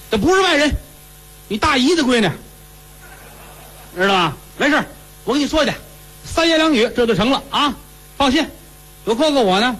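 high, brash big-brother voice; boastful cousin. Acted. Cousin assuring the lovesick boy it's settled, brash and high. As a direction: promise everything with swagger. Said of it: "cousin boasts he'll fix it".

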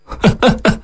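high, staccato narrated laugh; short burst of acted, scornful laughter; 仰天大笑. A narrator's reading. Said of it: "于太炎仰天大笑". (仰头几声高亢的笑)

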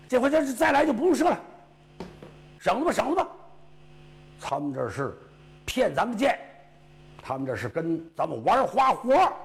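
high, loud and fast, worked up; excited soldiers shouting. Acted. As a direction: rattle it off like riled-up troops. Soldiers realize they were tricked.

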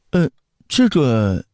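slow, high-pitched hesitation; stumped child. Acted. Prince has no answer about the empress dowager, stumped. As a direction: stall as a puzzled little boy. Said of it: "刘彻挠着头".

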